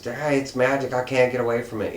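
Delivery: mock scream. Supergirl warning that she's caught by magic, mock scream. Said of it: "she even screams".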